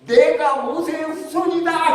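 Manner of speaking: high, slow mock proclamation; drunken shouting. Returning drunk claims descent from Moses, shouting grandly. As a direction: imitate a drunk's grand, drawn-out declaration. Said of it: "이번에는 뭐라고 소리치냐면".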